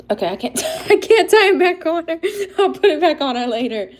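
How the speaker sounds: high, loud voice breaking into laughter; laughing surrender. Real, not acted. Failing to retie the bandana, laughing and squeaky. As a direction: give up through helpless laughter.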